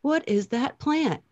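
slow, high, dismayed God realizing a mistake. Retold or imitated. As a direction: drawl it out like a stunned creator. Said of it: "he was like".

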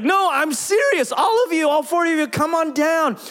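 high, urgent retold summons; insistent calling out. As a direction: imitate a pastor rallying the crowd, pitched well up. Pastor insists, calling visitors to stage.

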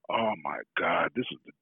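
low, hushed disbelief; quiet awe, retold as private thought; awed inner voice. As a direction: act out a stunned thought, dropping low and soft. His stunned inner reaction to offer.

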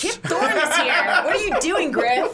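high, drawn-out mock telling-off, half laughing; mock scolding. Friend piling on about the important guest, mock scolding.

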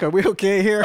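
nervous laugh through the words; timid check-in, laughing; laughing question. Retold or imitated. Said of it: "I'm like".

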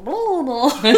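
loud, high, gleeful babble; hammed-up happy blah-blah; mock overjoyed. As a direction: burst back in, comically cheerful. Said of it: "Så här jätteglad".

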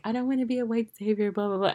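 quoting Miranda in a high, hammed-up voice; mocking Miranda's voice. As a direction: parody her like a clueless do-gooder. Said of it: "She's like".

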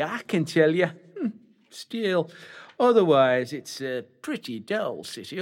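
high, hammed-up dame to the boys and girls; panto dame. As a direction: camp it up for the audience. Back in panto dame role.

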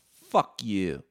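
loud retold snap at the shop owner; mock fuck you. Podcaster retelling telling Gord off, loud mock snarl. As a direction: spit it out, faking contempt. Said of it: "I'm like".

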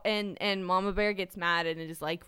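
ordinary talk

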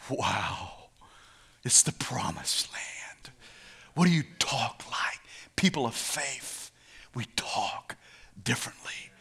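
soft, low half-whisper; hushed awe. Real, not acted. Preacher to people of faith, hushed half-whisper. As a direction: let it sink in, near whispering.

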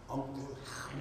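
barely above a whisper; softly, so he won't hear. Shown as a demonstration. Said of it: "I call him Uncle Hal softly".

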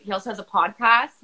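normal talking voice

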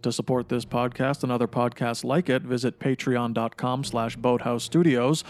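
low, measured promo read; announcer voice. Acted. Reads the podcast sponsor intro.

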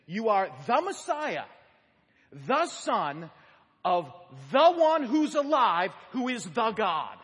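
loud, slow declaration with each "the" hammered; emphatic word-by-word translation, "the" hit hard; stress on every THE. Shown as a demonstration. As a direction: punch "the" every time, building in force. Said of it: "translate it really this way".

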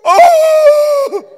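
high gasp and cry; John winded gasp. Retold or imitated. John punched in the ribs, high gasping cry. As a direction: gasp like the wind was knocked out. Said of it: "John takes a knee".

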